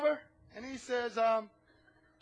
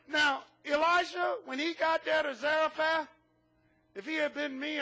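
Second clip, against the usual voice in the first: normal talking voice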